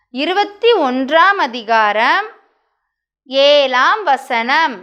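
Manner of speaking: very slow, stretched-out verse recitation; recite-along verse. Shown as a demonstration. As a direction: lead children through it with long pauses between phrases. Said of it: "சத்தமா சொல்லணும்". (சேர்ந்து சொல்ல மிக நிதானமாக நீட்டிச் சொல்லுதல்)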